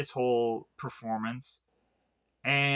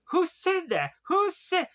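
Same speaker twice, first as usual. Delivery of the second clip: high, startled cry of who's there; 14 semitones higher than usual. Retold or imitated. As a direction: jump up high in alarm, looking around. Oda Mae startled by hidden voice.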